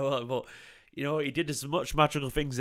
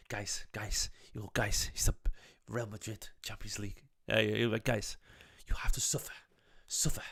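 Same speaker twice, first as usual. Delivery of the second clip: Guardiola; 2 semitones lower, 5 dB quieter than usual. Acted. Fan imagining the coach's post-match quotes, hushed imitation accent. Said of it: "I'll stop doing that accent now".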